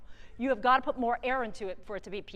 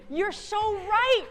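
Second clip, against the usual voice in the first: excited praise; 10 semitones higher, 6 dB louder, much slower than usual. Real, not acted. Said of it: "praising a student's answer".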